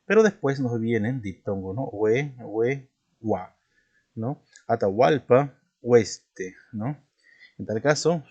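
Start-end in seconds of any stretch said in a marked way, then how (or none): none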